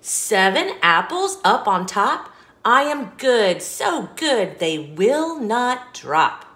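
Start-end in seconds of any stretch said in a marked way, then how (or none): none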